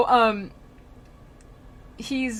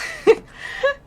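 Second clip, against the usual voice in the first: high, loud burst of laughter; short high laugh; real laughter; 8 dB louder than usual. Real, not acted. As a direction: crack up at your own joke. Laughs at own joke.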